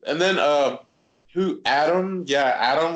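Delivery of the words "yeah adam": usual speaking voice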